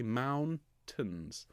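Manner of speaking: "mountains" drawn out with a light falling ending. Shown as a demonstration. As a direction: lean on "moun" in "mountains", then let "tains" drop away. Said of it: "the second syllable is quieter and slightly softer".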